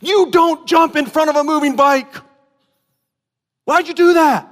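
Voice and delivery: high, loud alarmed shout at son; dad shouting at son who jumped before bike, loud and high; angry shout. Retold or imitated. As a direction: yell at him, startled and cross. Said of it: "I ran up to him and I said".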